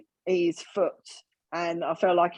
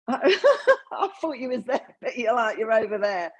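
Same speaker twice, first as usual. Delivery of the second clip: laughing quote; 5 semitones higher than usual. Retold or imitated. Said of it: "he'd be like".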